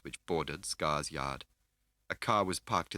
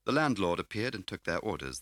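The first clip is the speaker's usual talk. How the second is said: plain reading voice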